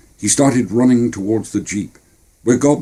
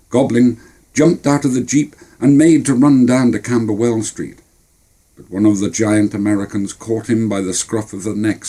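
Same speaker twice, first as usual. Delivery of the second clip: ordinary delivery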